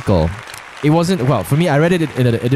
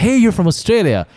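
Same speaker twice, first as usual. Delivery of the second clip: higher, eager exclamation; excited recognition; 8 semitones higher than usual. Acted. Hearing an Australian open their mouth, excited recognition. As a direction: call it out as a happy discovery.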